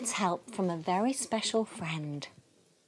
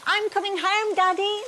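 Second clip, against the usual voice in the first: much higher, happy call to Daddy; 11 semitones higher than usual. A narrator's reading. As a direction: call ahead gleefully, bright and high. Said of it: "Little Rabbit called".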